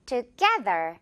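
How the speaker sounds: together with the middle syllable GE punched; stress on GE. Shown as a demonstration. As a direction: hit the middle of together, tu-GE-ther. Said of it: "깨 부분을 확 강하게요". (together 가운데 깨 음절에 강세)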